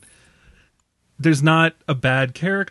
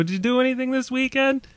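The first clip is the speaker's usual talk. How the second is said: lightly imitated Brooklyn accent, pitched higher; a hint of a chatty Brooklyn shopworker, higher voice; 8 semitones higher than usual. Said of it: "classic Brooklyn".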